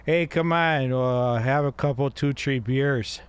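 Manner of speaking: tipsy Chicago regular; thick Chicago vowels, a little boozy; drunk Chicago accent. Acted. Drinker inviting pals for beers, drunk Chicago accent. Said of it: "Like a drunk Chicagoan".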